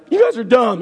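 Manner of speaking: loud, high mock-petulant retort; childish comeback. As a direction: blurt it like an upset teenager. Acts out childish comeback, running away.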